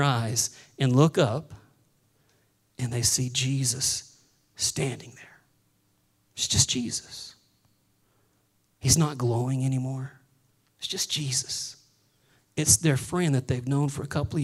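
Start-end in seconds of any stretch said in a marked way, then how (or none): 2.8-11.8 softer, lower, hushed telling; quiet reverent hush, words barely above a breath; hushed awe. Acted. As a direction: let it fall to a hush. Awed hushed moment after vision.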